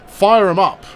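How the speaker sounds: rallying cry. Real, not acted. Rallying the team at half time.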